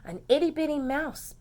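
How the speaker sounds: plain reading voice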